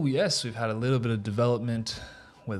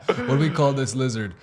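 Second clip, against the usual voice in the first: ordinary talk, nothing marked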